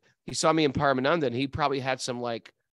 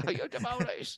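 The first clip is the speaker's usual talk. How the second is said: imitated hard Scottish accent, pitched higher; a higher, hammed-up Scottish shopkeeper accent; 10 semitones higher, 5 dB quieter than usual. Repeating the old Scotsman's question on request, in a hard Scottish accent. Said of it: "Can you say that again?".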